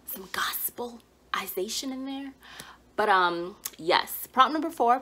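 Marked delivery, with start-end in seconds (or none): 0.1-2.3 soft whispered aside; joking whisper. Acted. Host to viewers, whispering a cheeky aside. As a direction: murmur it like a cheeky secret.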